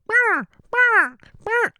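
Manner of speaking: very high, loud squawking bah; bird impression. Imitating a bird puffing up to mate, high loud squawks. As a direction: squawk like a puffed-up mating bird. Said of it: "That is not an exact, uh, impression".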